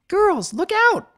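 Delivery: high, loud warning cry; filly shouting a warning to friends. Acted. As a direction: yell to friends to duck. Warning before the flash.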